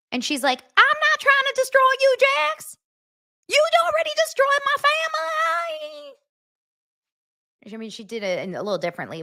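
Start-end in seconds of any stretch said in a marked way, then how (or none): 0.6-6.2 mock Southern Brittany. Brittany answering Jax, mock Southern crying. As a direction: wail it like an upset Southern woman. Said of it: "her accent is so insane".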